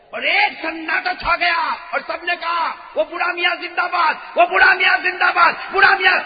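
high, loud stadium chant; preacher shouting the crowd's cheer. Acted. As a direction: roar it like the whole crowd chants. Crowd cheers the old man.

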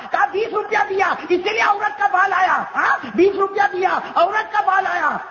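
high comic rant; losing his temper. Acted. Indian vendor losing his head at the customer, exasperated. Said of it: "Hindustan والے کا بھی دماغ گیا".

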